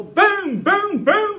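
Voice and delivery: three loud, high voiced blasts; booming mouth-made gunfire; imitated gunshots. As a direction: shout out a volley of shots. Imitates three shots behind him.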